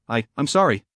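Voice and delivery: a touch of trembling, softly spoken; soft quivering voice. A narrator's reading. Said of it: "said in a soft quivering voice".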